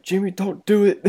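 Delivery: high put-on mother begging her son; pleading mom. Mom begging her drunk son to stop, lightly voiced. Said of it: "and he's like".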